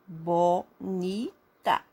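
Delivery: BO, NI, TA with clear gaps between; very slow BONITA, three separate beats; syllable by syllable. Shown as a demonstration. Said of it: "Abra a boca três vezes pra falar". (BONITA bem devagar, sílaba por sílaba)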